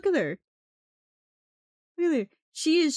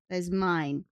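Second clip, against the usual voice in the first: usual speaking voice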